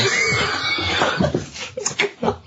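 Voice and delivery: very high squealing burst of laughter; shrieking laugh. Real, not acted. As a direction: lose it completely. Laughs at the wife line.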